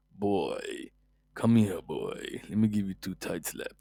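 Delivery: low, slow, menacing god-of-war voice; Kratos as stern father. Retold or imitated. Pundit imagines Kratos scolding Greenwood, low and threatening. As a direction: growl it like an angry warrior dad. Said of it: "if Kratos were to be Mason Greenwood's father".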